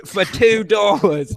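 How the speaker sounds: very slow, drawn-out mock Aussie read; Australian announcer voice. Announcing the donation amount in a slow mock Australian accent. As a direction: stretch it out like a clumsy Australian announcer. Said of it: "I'll read it in the announcer voice".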